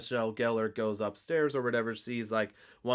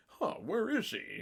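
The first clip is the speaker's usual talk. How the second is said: mock puzzlement; 8 semitones higher, 3 dB quieter than usual. Acts clueless Matthew wondering.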